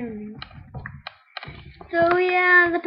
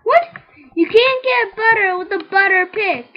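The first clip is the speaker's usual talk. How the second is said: loud, high and outraged; can't believe the game allows this; indignant outburst; 6 semitones higher, 11 dB louder than usual. Real, not acted. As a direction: protest it in disbelief.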